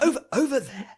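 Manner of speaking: high, loud overdone American voice; American accent. Retold or imitated. Podcaster mocks a conspiracy vlogger in an American accent. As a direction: ham up a Yank pointing at the skyline. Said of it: "in an American accent".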